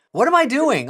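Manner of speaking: high, loud self-exasperated cry. Retold or imitated. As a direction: cry out at your own foolishness. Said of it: "I'm like".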